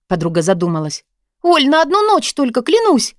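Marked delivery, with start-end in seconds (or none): 1.4-3.1 high, urgent little plea; pleading. A narrator's reading. Woman swears to her friend it's one night, pleading. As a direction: push the voice high and beg.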